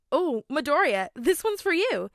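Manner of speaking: high, loud eager call; bursting with excitement over the gift; excited. A narrator's reading. Kirishima handing Midoriya a present, excited and high. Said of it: "he exclaimed excitedly".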